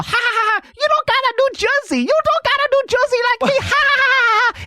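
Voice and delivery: mock the kid, high and teasing; taunting kid voice. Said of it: "he even has the stereotypical voice".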